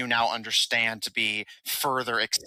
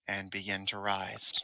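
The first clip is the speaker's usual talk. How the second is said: ordinary talk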